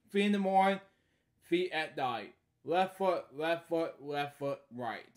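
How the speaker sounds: lively sing-song read-aloud; storybook reading. Acted. Reading a bedtime story aloud, lively. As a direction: read each line brightly to a child.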